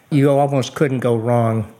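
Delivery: ordinary delivery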